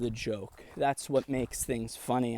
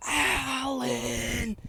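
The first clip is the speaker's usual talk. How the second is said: mock yell; 11 semitones higher than usual.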